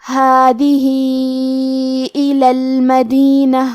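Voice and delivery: very slow recitation of ہادہی الی المدینہ, ha vowel stretched two to four counts; long madd. Shown as a demonstration. Teacher reciting for students, stretching the madd. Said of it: "آواز دو سے چار مد کے برابر لمبی".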